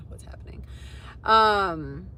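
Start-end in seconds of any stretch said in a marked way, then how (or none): 0.1-0.6 hushed unvoiced whisper off to the side; whispered aside. Real, not acted. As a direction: whisper it so the viewers barely catch it. Muttering aside to someone off-mic.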